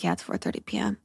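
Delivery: very low, soft imitation of him; deadpan confirmation. Companion confirming it is four thirty, deadpan. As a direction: drop the pitch right down, quietly.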